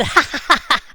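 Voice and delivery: loud, high mock laugh; teasing laugh, acted out. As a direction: burst into a loud put-on cackle. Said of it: "And then he laughed".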